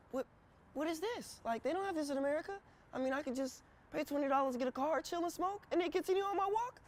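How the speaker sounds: high, quick, incredulous rush; amazed disbelief. Retold or imitated. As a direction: squeak out the questions in wonder. Retelling shock at weed club.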